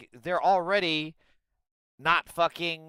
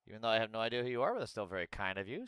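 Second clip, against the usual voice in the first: usual speaking voice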